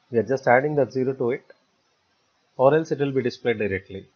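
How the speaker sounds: usual speaking voice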